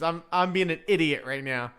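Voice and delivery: usual speaking voice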